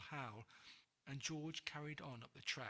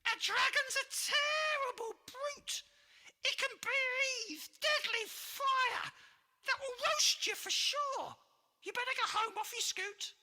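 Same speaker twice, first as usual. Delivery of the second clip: very high, loud witch voice in fear; shrill panicky witch, slowing on the fire line; frightened witch; 11 dB louder than usual. Acted. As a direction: warn him off, terrified. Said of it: "she trembled with fear".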